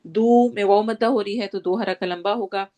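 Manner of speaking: ordinary talk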